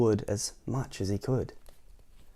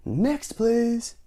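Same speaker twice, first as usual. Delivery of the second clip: high, brusque call for the next one; dismissive shout; 12 semitones higher than usual. Acted. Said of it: "shouted the grocer".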